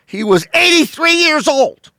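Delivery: high mock-astonished yelp; mock disbelief. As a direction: squeak it out in fake shock. Incredulous punchline on age.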